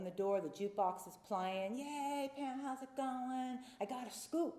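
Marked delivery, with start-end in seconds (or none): 1.8-4.6 high, drawn-out mock welcome; cheery bar greeting. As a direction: imitate bar regulars hailing a friend in a higher voice. Bar friends greeting her, acted out.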